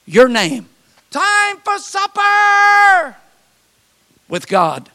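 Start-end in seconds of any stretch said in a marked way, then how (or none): 1.1-3.2 high, drawn-out calling holler; long, slow cry held on a high note. Retold or imitated. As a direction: sing it out like a mother's supper call. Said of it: "All nature cries out".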